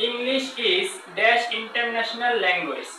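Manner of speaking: very slow, pausing on the word dash; slow dictation with a gap; slow blank example. Shown as a demonstration. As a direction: read the gapped sentence slowly. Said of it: "দেখেন, example".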